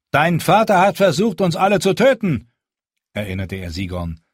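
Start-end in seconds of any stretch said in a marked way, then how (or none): 0.1-2.5 high, loud and firm, a leader addressing a crowd; Jon's commanding speech. Acted. Jon rallies the hungry wildlings, firm and loud. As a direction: speak up to be heard by the whole camp.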